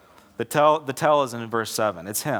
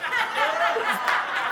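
real burst of high laughter; preacher laughing at his own punchline. Real, not acted. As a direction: crack up at your own joke. Preacher cracks up at own joke.

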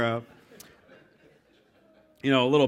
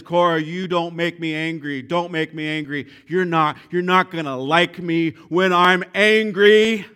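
mock Hulk threat, higher and louder by the end; Hulk-style warning; 3 semitones higher, 8 dB louder than usual. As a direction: build the warning up into a growl of anger. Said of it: "a righteous indignation begins to burn".

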